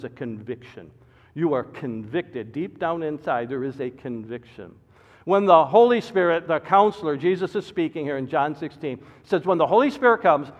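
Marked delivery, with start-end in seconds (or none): 5.3-10.5 loud, high, driving pulpit delivery; preaching at full force. Acted. Pastor warning his congregation, preaching at full force. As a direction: hammer the warning home from the pulpit.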